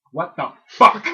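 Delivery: loud mock-outraged mom; mom's catchphrase. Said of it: "my mom was, like, a big on".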